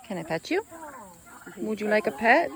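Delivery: ordinary talk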